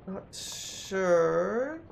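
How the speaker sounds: a puzzled, sing-song shrug; drawn-out musing. Real, not acted. Player puzzling over a sailor's fate, drawn-out rising musing.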